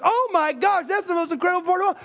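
high, fast excited woman; excited gushing. Retold or imitated. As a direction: rush it out, thrilled. Woman voiced in the joke.

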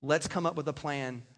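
gentle I statement. Shown as a demonstration. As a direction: reassure your wife about the savings. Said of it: "a little bit more like this".

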